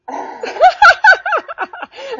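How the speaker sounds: loud, high burst of laughter; real laughter. Real, not acted. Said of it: "I started laughing".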